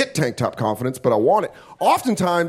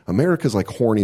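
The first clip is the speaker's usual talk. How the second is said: usual speaking voice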